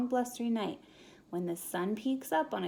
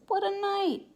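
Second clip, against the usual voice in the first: mole voice; 11 semitones higher, 6 dB louder than usual. Acted. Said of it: "Mole mutters".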